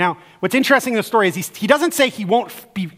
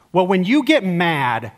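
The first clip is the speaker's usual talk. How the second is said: ordinary delivery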